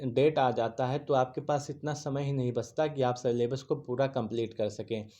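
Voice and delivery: usual speaking voice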